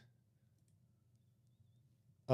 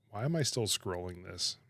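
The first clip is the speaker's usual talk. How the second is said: ordinary talk, nothing marked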